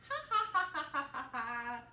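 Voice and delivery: loud, high ha-ha-ha tumbling down; big laughter. A narrator's reading. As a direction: belt out a storybook belly laugh. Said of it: "Gerald thought that was so funny".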